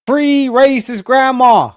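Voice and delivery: high, loud, slow slogan call; mock rallying cry. Streamer jokes to chat about freeing the suspect, mock rallying cry. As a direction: drag it out like a joke protest chant.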